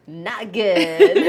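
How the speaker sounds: loud, breaking into laughter; laughing verdict. Real, not acted. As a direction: blurt it out loudly, then laugh. Laughing at own bad accent attempt.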